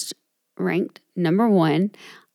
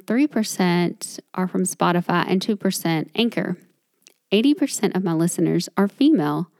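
ordinary delivery

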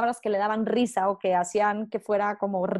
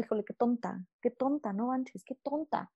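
muttered self-talk; 8 dB quieter than usual. Retold or imitated. Diálogo interno negativo, en voz baja.

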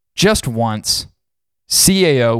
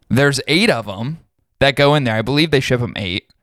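ordinary delivery

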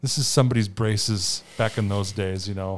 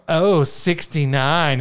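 slow drawn-out caller voice, higher and louder; bingo caller; 5 semitones higher, 6 dB louder, much slower than usual. Retold or imitated. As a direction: stretch out the number like a bingo caller. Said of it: "so the bingo caller".

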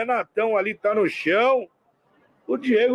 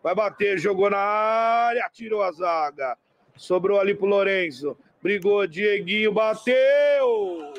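play-by-play commentary; 4 semitones lower than usual. Acted. Live match commentary.

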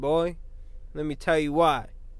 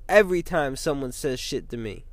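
ordinary talk

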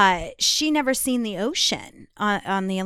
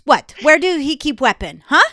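imitated Russian roommate, loud and brusque; amateur Russian accent, punching up the last words; 4 semitones higher, 7 dB louder than usual. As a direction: fire questions in a rough Russian accent. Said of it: "she was Russian, so I can't do a very great".

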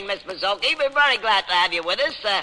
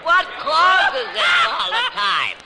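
slow, laughing through the question; half laughing, amused; 13 semitones higher, 4 dB louder, much slower than usual. Real, not acted. Host laughing amid audience laughter.

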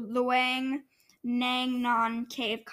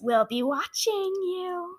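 slow, ominous creepy whisper-tease; 5 semitones higher, slower than usual. Acted. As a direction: say it slow and sinister. Creepy joke sign-off.

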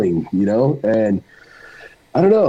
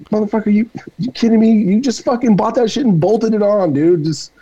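mocking disbelief; 6 semitones higher than usual. Real, not acted. Biker scoffing at guys claiming they built their bikes, incredulous. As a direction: scoff at them, you can't be serious. Mocking the bragging bike owners.

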